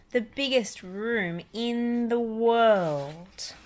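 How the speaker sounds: slow, drawn-out wonder; awestruck and slow. A narrator's reading. Mother reading to her child, slow and amazed. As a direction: stretch it out in amazement. Awed narration of huge room.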